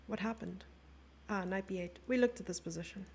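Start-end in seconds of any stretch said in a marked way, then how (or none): none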